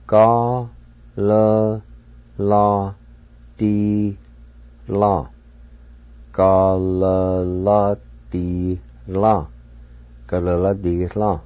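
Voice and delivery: Ka, la, la, di, la with a pause after each syllable; syllables spaced out. Shown as a demonstration. Teacher breaks the word into syllables for learners. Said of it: "twice in Cherokee".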